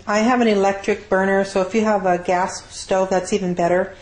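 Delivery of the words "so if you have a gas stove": ordinary delivery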